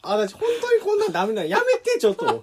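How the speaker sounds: mock Kansai woman. Friend's impression of a Kansai woman, high and giggly. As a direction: play a flustered woman, breaking into laughs. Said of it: "全然似てないよ".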